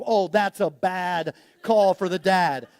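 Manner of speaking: ordinary talk, nothing marked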